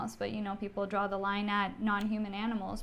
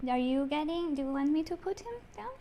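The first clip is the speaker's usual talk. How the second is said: high sing-song coo to a pet; 5 semitones higher, 3 dB quieter than usual. Real, not acted. Hosts asking their dog to go lie down, high cooing baby talk. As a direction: coo it like talking to a puppy.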